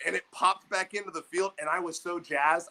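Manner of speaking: usual speaking voice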